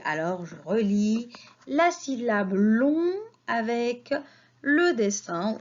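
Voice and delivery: ordinary talk, nothing marked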